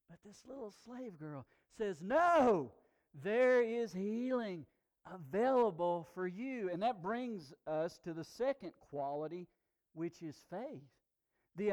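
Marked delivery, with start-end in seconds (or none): 2.0-6.7 higher, louder, slow and firm; emphatic no. Acted. Little slave girl boldly telling her master. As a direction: insist with hopeful conviction. Slave girl's bold reply.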